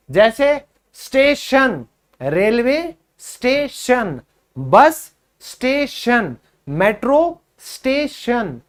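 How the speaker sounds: स्टेशन said slowly, each ending a clear "shun"; slow pronunciation drill. Shown as a demonstration. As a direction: list the स्टेशन examples slowly for repetition. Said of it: "आपको कहना है शन". (धीरे-धीरे स्टेशन का उच्चारण)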